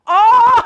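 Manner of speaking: loud, high "oh" of shock; shocked shout. Real, not acted. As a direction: cry out high and loud in surprise. Shocked reaction to next picture.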